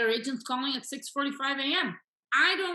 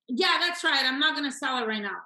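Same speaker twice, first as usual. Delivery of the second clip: louder, higher, emphatic confirmation; insistent seller; 3 semitones higher, 5 dB louder than usual. Acted. Coach playing seller off the market, insistent. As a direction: double down on the refusal.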